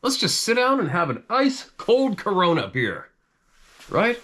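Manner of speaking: high, bright burst of relief; relieved and excited. Retold or imitated. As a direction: celebrate finally arriving, voice way up. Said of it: "It's like".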